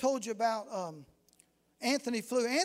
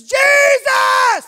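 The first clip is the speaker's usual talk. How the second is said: long, much higher shouted Jesus; shouted cry to God; 14 semitones higher, 18 dB louder than usual. Retold or imitated. Drunk man in the church crying out to God, shouting. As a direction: cry out to heaven at full voice. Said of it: "And he says".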